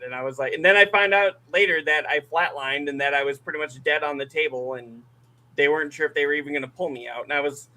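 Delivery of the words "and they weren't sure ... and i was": usual speaking voice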